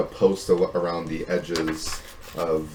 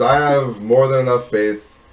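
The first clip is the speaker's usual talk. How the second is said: loud, raised in-character voice; mercenary's firm reply; 6 semitones higher, 8 dB louder than usual. Acted. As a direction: declare it firmly at them. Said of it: "He goes".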